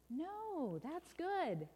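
high, lilting contradiction; cheery correction. Acted. Storyteller overturns bad news, high and reassuring. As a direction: sing out the good news.

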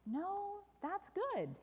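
high, lilting contradiction; cheery correction. Acted. Storyteller overturns bad news, high and reassuring. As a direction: sing out the good news.

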